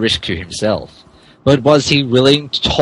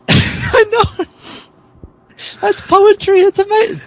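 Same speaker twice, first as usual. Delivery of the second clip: laughing delight. Real, not acted. Amused praise of a line.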